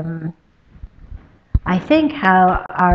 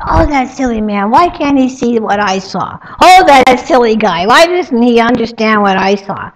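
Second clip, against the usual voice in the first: annoyed grumbling; 4 semitones higher, 8 dB louder than usual. Acted. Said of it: "she gets so annoyed".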